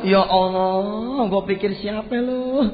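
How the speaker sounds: slow, stretched-out exclamation on hugging a friend; relieved greeting. Retold or imitated. Malik hugs his unexpected old friend, acted drawn-out relief. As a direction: draw out the relief, acting it. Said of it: "sambil melok nih tamu".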